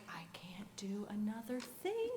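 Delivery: hushed, breathless whisper of defeat; softly whispered despair. Real, not acted. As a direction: whisper it, worn out. Overwhelmed at the breaking point.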